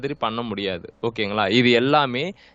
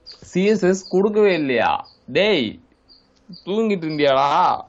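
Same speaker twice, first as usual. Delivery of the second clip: raised, high-pitched disbelief at the student; exasperated scolding; 6 semitones higher, 3 dB louder than usual. Real, not acted. Teacher to student who missed the CSS step, exasperated. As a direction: scold like they slept through class.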